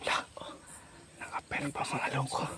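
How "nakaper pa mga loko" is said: very soft, half-whispered remark; hushed muttering. Real, not acted. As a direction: whisper it under your breath. Hiding while watching others.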